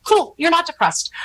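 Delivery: high, playful "okay, Cameron, cool"; humouring friend. Retold or imitated. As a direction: go along with her, voice lifted high. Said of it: "she's like".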